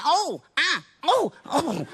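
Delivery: high, hammed-up "oh" and "ah" moans; high put-on moans of pleasure; exaggerated comic love-making cries; mock sex moans. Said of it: "I'm doing much going".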